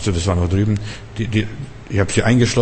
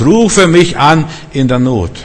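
RUFE raised high and stretched; slow, ringing call, leaning on RUFE; emphatic quotation; 5 semitones higher, 4 dB louder, slower than usual. Acted. Preacher quoting God's promise, RUFE drawn out emphatically.

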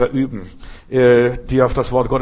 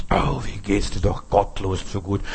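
lament; 6 semitones lower, 3 dB quieter than usual. Acted. Voicing Asaf's envious lament.

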